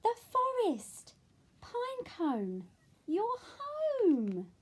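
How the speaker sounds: high, loud joyful shout; excited cry. Acted. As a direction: burst out with joy. Arrives at forest.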